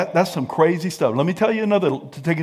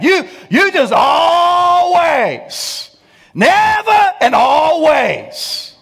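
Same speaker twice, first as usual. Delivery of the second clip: high, loud, slow hammed-up accusation; mock yelling spouse; 10 semitones higher, 6 dB louder, slower than usual. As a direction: shout always at your partner.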